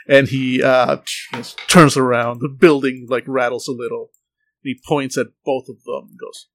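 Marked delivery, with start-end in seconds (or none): none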